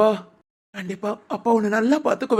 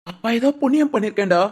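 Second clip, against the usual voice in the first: ordinary talk, nothing marked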